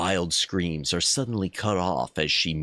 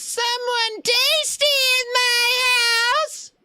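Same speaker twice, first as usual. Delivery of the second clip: very high, slow squeaky witch voice; 4 dB louder, slower than usual. Acted. Narrating a text game, voicing the witch high and squeaky. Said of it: "you hear a high, squeaky voice say".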